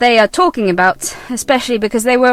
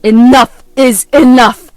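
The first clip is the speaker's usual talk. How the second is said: firm; 7 dB louder, slower than usual. Acted. Son ends argument.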